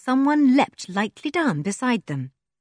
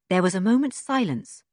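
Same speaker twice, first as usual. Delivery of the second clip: plain reading voice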